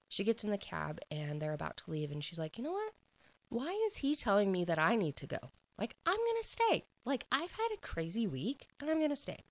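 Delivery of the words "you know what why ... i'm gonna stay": high-pitched, indignant mock protest; defiant refusal. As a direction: act out someone stubbornly digging in. Retold woman defiantly refusing to go.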